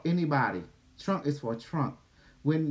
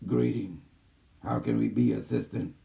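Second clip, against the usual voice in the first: lightly voiced calm AI system; a hint of a measured machine voice; gentle care robot; 5 semitones lower than usual. Channels the AI system.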